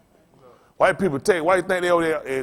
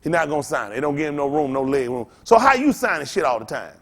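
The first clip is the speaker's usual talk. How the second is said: ordinary delivery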